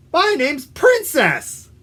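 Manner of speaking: high-pitched proud character; dog named Princess. Acted. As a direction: announce your name as a big surprise. Dog reveals her name.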